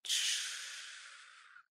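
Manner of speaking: soft long hush; gentle shushing; soothing shush. Acted. Dragon soothes crying girl.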